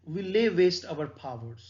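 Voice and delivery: very slow, deliberate recitation; slow solemn poem reading. Acted. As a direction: savour each word of the verse. Reads the sonnet line.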